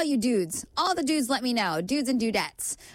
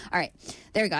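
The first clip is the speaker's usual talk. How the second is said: ordinary talk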